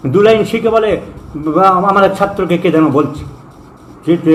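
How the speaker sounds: normal talking voice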